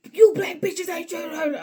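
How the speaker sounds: high, loud sneering rant; a hammed-up furious insult; mock angry man. Man's insult voiced.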